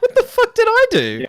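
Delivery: high squeaky bewildered yelp through a laugh; mock innocent shock, voice shooting up; baffled outcry. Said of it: "I'm like".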